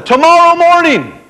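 rally them for the flight; creator instructing birds. A narrator's reading. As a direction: creator giving the birds their task. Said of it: "so he said to all of them".

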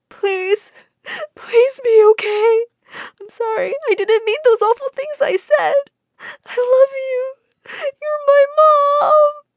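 high, loud sobbing plea; crying plea. A narrator's reading. Jane at the widow's hospital bed, sobbing. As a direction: beg through tears. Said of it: "started to cry".

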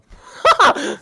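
sudden loud, high laugh; high burst of laughter. Real, not acted. Laughs at own joke.

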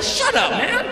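high-pitched, drawn-out "shut up"; mock indignant hushing. Nervous rider hushing a teasing stranger beside his girl, indignant. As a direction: hush him in a squeaky, embarrassed protest.

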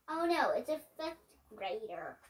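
ordinary delivery, nothing marked

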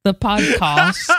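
"podcast" in a put-on British accent; slow, careful British-sounding "the podcast". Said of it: "That's how you say it".

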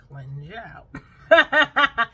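very slow, loud, laughing through it; drawn-out laughing. Shown as a demonstration. Vlogger joking about detox tea cleansing you out, laughing. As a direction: stretch it out and crack up. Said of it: "drawn out, laughing repeat".

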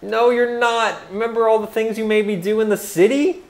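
angry. Acted. Angry at the demon.

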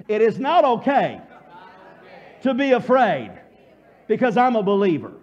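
loud, emphatic declaration; booming, convinced affirmation; with conviction. Shown as a demonstration. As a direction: proclaim it forcefully for the crowd to echo. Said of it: "Say it a little bit more with conviction".

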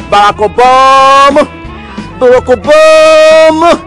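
loud, high, long-drawn name; showy drawn-out bellow; booming phone greeting. Acted. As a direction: holler the name to a famous pal. Fake phone call hailing Obama.

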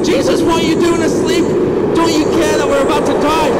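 high-pitched frantic plea over the storm; alarmed plea. Acted. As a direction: play a panicked disciple waking the teacher. Disciple waking Jesus in the storm.

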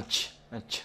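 unvoiced "ch, ch" puffed out alone. Shown as a demonstration. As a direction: isolate "ch" as a short burst of air. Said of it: "thì sẽ phát âm là âm". (bật hơi "ch, ch", không rung giọng)

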